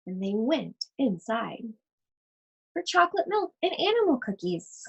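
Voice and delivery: put-on lively read-aloud voice for children; story reading. Teacher reading a picture book to her class, animated storytelling.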